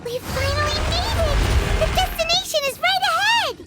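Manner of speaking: high, bright cries of arrival; excited. Acted. Paimon cheering on reaching the destination, excited high voice.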